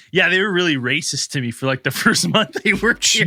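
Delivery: a hint of a laughing, aggrieved bartender; lightly voiced bartender. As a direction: complain about past bullying, half laughing. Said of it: "He's like".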